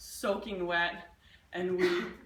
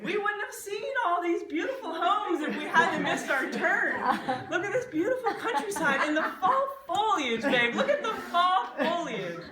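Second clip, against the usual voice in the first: high, laughing mock-rosy tour guide cheer; mock cheerful; 8 semitones higher, 4 dB louder than usual. Husband mocking his own cheer while lost on the honeymoon. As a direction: sell the sunny side with sarcastic glee.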